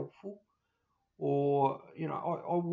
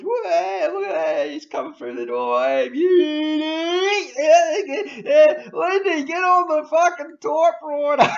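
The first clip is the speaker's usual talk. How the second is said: much higher and louder, crowing with glee; gleeful greedy advisers; 12 semitones higher, 14 dB louder than usual. Retold or imitated. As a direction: whoop like a greedy adviser spotting a mark. Mocking advisers celebrating a client.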